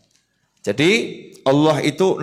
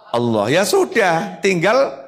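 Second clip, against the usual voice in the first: usual speaking voice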